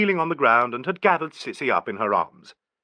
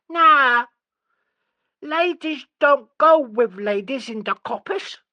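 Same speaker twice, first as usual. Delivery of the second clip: put-on high whiny child's nah; whining child; 6 dB louder, slower than usual. A narrator's reading. Sissy refusing the question, whining. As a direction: whine it like a sulky girl.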